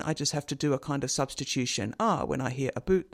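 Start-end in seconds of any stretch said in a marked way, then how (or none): 2.7-3.1 Scottish oo vowel in "about"; Scottish accent. Shown as a demonstration. Lecturer explaining sound substitution, Scottish accent. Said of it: "when I hear Stephen's accent".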